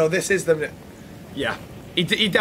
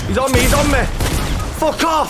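high, urgent yell under attack; panicked callout; 6 semitones higher than usual. Real, not acted. Gamer under attack warning teammates, panicked yell. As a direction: shout it as the enemy closes in.

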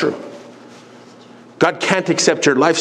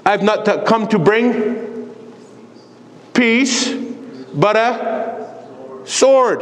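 higher, slow proclamation with weighty pauses; quoting Jesus; 5 semitones higher, much slower than usual. Retold or imitated. Preacher quotes Jesus to the church, measured proclamation. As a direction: declare it word by word as Jesus. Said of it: "What did Jesus say?".

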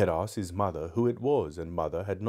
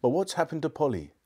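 higher, indignant demand; 7 semitones higher than usual. A narrator's reading. Diggory asks what happened to Polly, indignant. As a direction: demand an answer with raised pitch. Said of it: "said Diggory indignantly".

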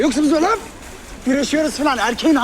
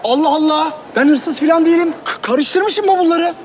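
loud protest; 8 semitones higher, 4 dB louder than usual. Acted. Man protesting his innocence.

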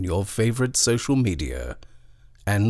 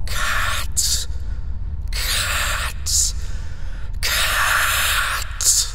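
cruel flat whisper; much slower than usual. A narrator's reading. Nightmare creature hissing at the child, cruel toneless whisper. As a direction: breathe each cat out without voice. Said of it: "a voice, cruel and flat, saying".

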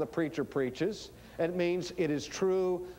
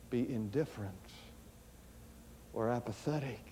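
lower, halting, with long pauses; solemn hush; 6 semitones lower, 9 dB quieter than usual. Real, not acted. Preacher asking how we can be indifferent, solemn hush. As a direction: weigh each phrase, sinking softer.

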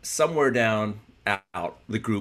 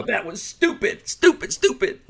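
much higher on each stupid, building and louder, into a laugh; scolding his younger self, climbing higher with every stupid; self-mocking scold; 5 dB louder, slower than usual. Boxer mocking his macho younger self, rising to a laughing squeal. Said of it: "now I look back and go".

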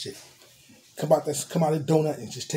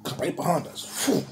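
ordinary delivery

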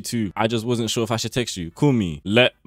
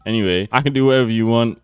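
ordinary talk, nothing marked

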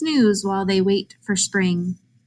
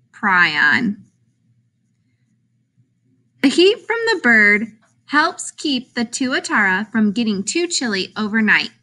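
ordinary delivery

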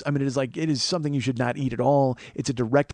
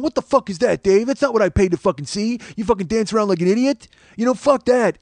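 mildly mocking irate heckler, pitched up; indignant ranting, higher than usual; heckler yelling; 6 semitones higher, 3 dB louder than usual. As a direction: retell a loudmouth fan's rant. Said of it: "he's yelling, and he's being loud".

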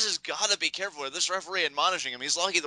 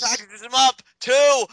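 loud rising near-fall call; excited count; 9 semitones higher, 7 dB louder, slower than usual. Real, not acted.

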